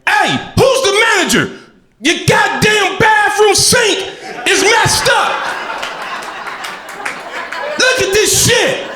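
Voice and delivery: hammed-up indignant shout at the manager; fake angry outburst.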